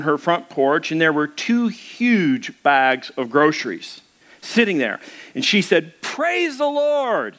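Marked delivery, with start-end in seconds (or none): 6.0-7.3 put-on old lady's joyful cry. A narrator's reading. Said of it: "and she cried out".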